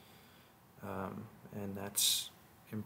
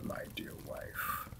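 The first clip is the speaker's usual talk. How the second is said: whispered endearment; 7 dB quieter than usual. Acted. Intimate closing words to wife.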